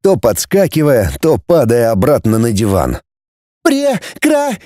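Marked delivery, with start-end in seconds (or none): 3.6-4.6 high, shrieking woman's voice; shrill woman cry. A narrator's reading. Woman's shriek in narrated scene.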